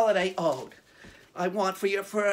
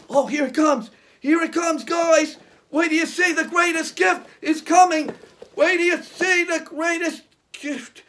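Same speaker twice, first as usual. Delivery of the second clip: excited; 6 semitones higher, 5 dB louder than usual. Acted. Excited magician expecting gift.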